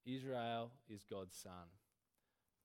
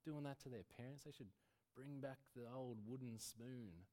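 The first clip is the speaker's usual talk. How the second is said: hushed gossiping busybody; gossiping whisper; 6 dB quieter than usual. Retold or imitated. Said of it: "those people saying".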